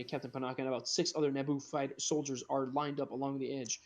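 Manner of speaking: ordinary talk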